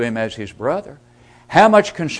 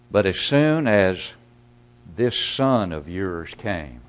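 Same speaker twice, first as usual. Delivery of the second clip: slow, scornful "this son of yours"; contemptuous tone; 6 semitones lower, slower than usual. Shown as a demonstration. Elder brother scorning his returning brother to the father. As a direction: sneer the words "this son of yours" slowly. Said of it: "can you hear that?".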